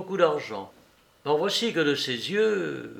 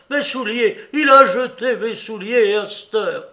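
high, loud outraged protest; old man bellowing about his shoes; roaring; 7 semitones higher, 8 dB louder than usual. A narrator's reading. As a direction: cry out in scandalised disbelief. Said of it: "rugit le père Soupe".

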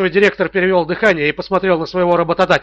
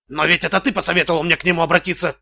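plain reading voice

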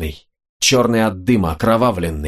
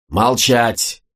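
firm, contained order to be silent; mildly forceful narrated command; powerful command. A narrator's reading. Butrym silences the crowd, restrained powerful command. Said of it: "крикнул мощным голосом".

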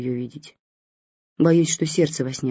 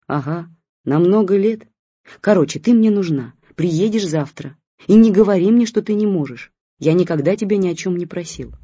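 put-on grumpy old man; 3 semitones higher, 4 dB louder than usual. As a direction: scold her down the line like a hurt old friend. Old man demands a visit.

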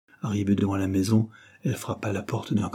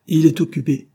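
loud, higher, curt rebuff; rude; 5 semitones higher, 6 dB louder than usual. A narrator's reading. Young Anthony Dare rudely turning Jane away, a loud curt rebuff. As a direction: snap it back at her. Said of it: "répéta rudement Anthony".

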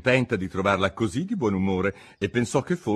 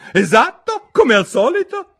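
much higher, loud, with a laugh; laughing duchess; 11 dB louder than usual. A narrator's reading. Duchess laughs her agreement, high and loud. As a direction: chuckle with smug delight.

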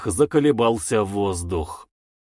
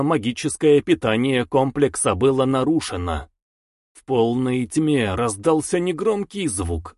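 usual speaking voice

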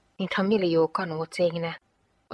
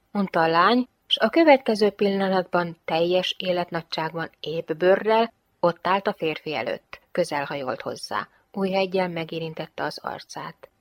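ordinary delivery

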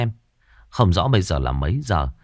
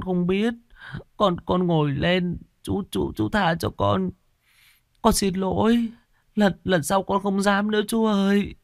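put-on high, trembling child's begging; frightened pleading; 9 semitones higher than usual. A narrator's reading. Scared child begging the ghost uncle for forgiveness, high pleading voice. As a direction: beg the angry ghost for mercy like a scared kid. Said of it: "thành tâm xin xỏ".

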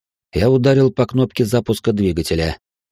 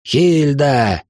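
higher-pitched shout over engine roar; barked call; 6 semitones higher than usual. A narrator's reading. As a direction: call the name out sharply over the noise. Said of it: "рявкнул я, перекрывая грохот".

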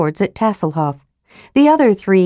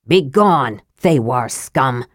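commanding dwarf; 6 semitones lower, slower than usual. A narrator's reading. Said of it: "Flint commanded".

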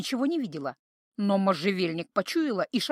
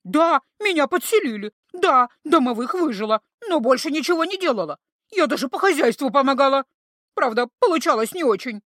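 loud, high hysterical denial; panicked screaming denial; 4 semitones higher, 6 dB louder than usual. A narrator's reading. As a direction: thrash and shriek it out. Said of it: "забилась в истерике нежить".

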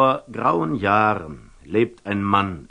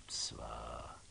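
"Zwar" breathed out as a soft whisper; a whispered "Zwar" while thinking; whispered hesitation; 10 semitones lower, 20 dB quieter than usual. Acted. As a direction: catch yourself in a whisper on "Zwar". Hesitant aside, word repeated.

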